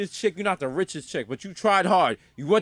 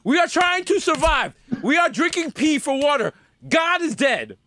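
preachy rant; 6 semitones higher than usual. Retold or imitated. Telling plane crash survivors to stop praying, mildly raised rant. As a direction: lecture the survivors about praying. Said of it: "I was like".

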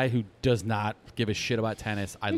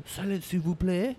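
put-on higher-pitched French accent; polite French hush, pitched up; French guard; 7 semitones higher, 4 dB quieter than usual. Guard hushing French tourists, French accent.

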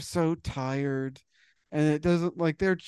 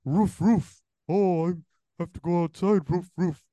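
higher cartoon dog barking between words; a lightly higher talking dog going roof roof; 5 semitones higher than usual. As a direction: bark it out like a needy cartoon pup. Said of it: "they have to make the noises".

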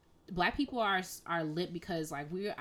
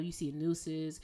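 normal talking voice

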